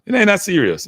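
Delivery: It ain't that serious tossed off high, with the weight on ain't; teasing dismissal. Retold or imitated. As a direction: brush off the friend's anger with a grin. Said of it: "And I was like".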